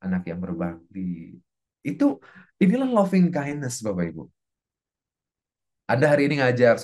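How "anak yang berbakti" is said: hushed, low supplication; very soft, low blessing; parent's prayer. Shown as a demonstration. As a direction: breathe a soft, private prayer for your child. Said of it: "sambil kita doakan".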